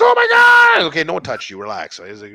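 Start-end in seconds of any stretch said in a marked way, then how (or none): 0.0-0.8 mock ecstatic woman. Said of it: "She's like".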